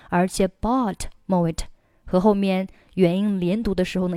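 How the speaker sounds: usual speaking voice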